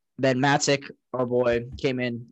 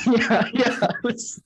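higher-pitched, laughing agreement; laughing admission; 8 semitones higher, slower than usual. Real, not acted. Owning up to the text a co-host remembers, laughing. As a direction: say it through a laugh.

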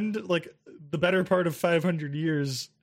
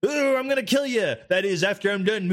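goofy orc. Retold or imitated. New goofball orc threatening the player, goofy. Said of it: "And now it's like".

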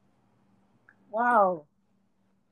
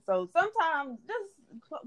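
normal talking voice

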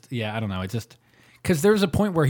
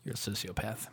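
much softer, under the breath; quiet aside; 3 semitones lower, 15 dB quieter than usual. Real, not acted. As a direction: drop it to a near hush. Aside insult, voice dropped low.

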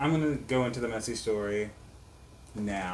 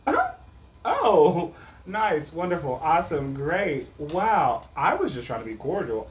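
sarcastic; 5 semitones higher, 5 dB louder than usual. Retold or imitated. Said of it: "And I'm like".

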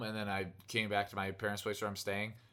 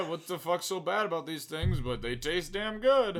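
higher, louder, slowing to a drawn-out finish; old-time smoker; 7 semitones higher, 6 dB louder than usual. Retold or imitated. Friend mocking old-time smokers, higher and louder, slow and carefree. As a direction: play a carefree smoker who sees no harm. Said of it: "same thing with cigarettes back in the day".

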